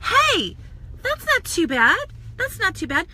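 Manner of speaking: high, bright "hey" of happy surprise; pleasantly surprised at yourself in the mirror; pleased surprise. Retold or imitated. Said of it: "I'm all".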